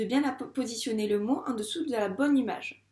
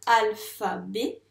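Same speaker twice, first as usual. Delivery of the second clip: "alphabet" slower and louder, in three beats; syllable by syllable; 5 dB louder, much slower than usual. Shown as a demonstration. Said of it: "si on a trois syllabes". (« alphabet » découpé en trois syllabes, bien fort)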